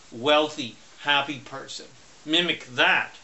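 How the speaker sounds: ordinary talk, nothing marked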